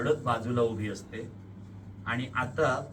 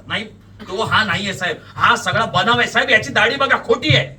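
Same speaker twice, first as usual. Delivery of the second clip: loud protest climbing in pitch; desperate shouting; 7 semitones higher, 13 dB louder than usual. A narrator's reading. Suspect to police about the fake beard, shouting. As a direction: shout out, unable to hold back. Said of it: "न राहून तुम्ही ओरडतात".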